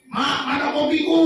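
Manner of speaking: "Mak, mana kopiku?" called out like a lazy husband; mock demanding husband. Husband demands coffee, acted. (suami manja meneriaki istri minta kopi)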